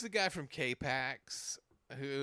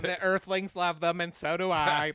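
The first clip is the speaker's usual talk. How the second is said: loud, raised alien character voice; Alien Sling Blade; 4 semitones higher, 6 dB louder than usual. Acted. Friends riffing on alien monologues, Alien Sling Blade voice. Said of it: "Alien Sling Blade".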